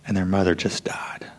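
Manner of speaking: hushed, broken-hearted whisper; grieving father whispers the loss; whispered grief. Retold or imitated. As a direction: let the news fall to a whisper.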